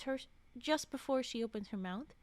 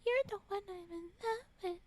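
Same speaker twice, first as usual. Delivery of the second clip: higher, teasing swoony lover voice; mock love confession; 7 semitones higher, faster than usual. Streamer mocking the romantic line, swooning mock confession. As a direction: ham up a lovesick confession. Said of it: "Don't say it like it's".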